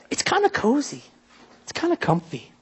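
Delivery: soft, gentle cozy tone. Real, not acted. Preacher describing a warm barn, gently soft. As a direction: coo it softly. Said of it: "describing barn softly".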